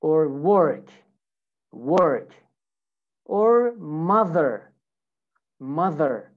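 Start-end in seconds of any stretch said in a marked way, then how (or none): 0.3-2.3 American "work" with the R sounded; American accent. Shown as a demonstration. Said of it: "my accent is American". 3.8-6.3 American "mother" ending on a sounded R; slow, clear American "mother"; American accent. Shown as a demonstration. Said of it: "so this is America".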